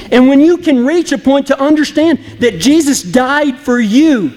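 usual speaking voice

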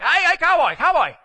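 high, excited call to get someone's attention; calling Cajun guide. Retold or imitated. Alligator guide calls to the cowboy in his boat, high mock holler.

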